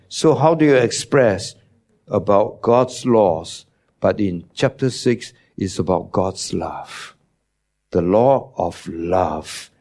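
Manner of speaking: ordinary talk, nothing marked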